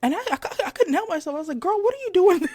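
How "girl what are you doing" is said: higher, louder amused blurt; playful exclaiming. Retold or imitated. Cashier to a customer buying tubs of Cool Whip, playful exclaiming.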